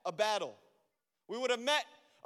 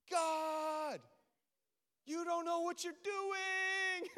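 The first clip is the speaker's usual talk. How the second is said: higher, slow, half-laughing complaint; mock yelling at God; 6 semitones higher, 3 dB quieter, much slower than usual. Pastor retells yelling at God, slow mock complaint. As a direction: play at scolding God, not in earnest. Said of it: "how many times I've been".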